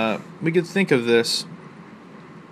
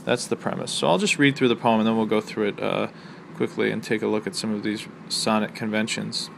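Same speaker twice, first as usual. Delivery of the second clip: ordinary talk, nothing marked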